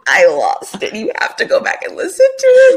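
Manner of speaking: high, fast and loud through laughter; laughing. Real, not acted. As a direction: urge a friend while cracking up. Still laughing at story.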